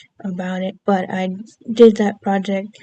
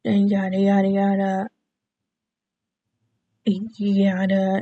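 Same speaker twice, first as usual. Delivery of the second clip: mock line reading with a long pause; halting recitation; slower than usual. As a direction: stall mid-line as if recalling the script. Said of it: "have to pause a few times".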